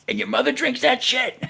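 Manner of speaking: high-pitched mock outraged yell; retold screaming. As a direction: replay hollering it after him in disbelief. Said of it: "And I'm screaming at him".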